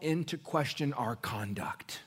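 loud, high, slow pulpit climax; full-force preaching. Acted. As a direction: hammer the point home to the church.

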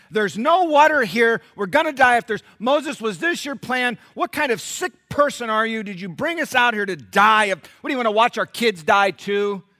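loud, high, mocking complaint; snarky loud accusation; sarcastic snark. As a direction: sneer at Moses with sarcasm. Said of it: "the sarcasm and the snarkiness in their voice".

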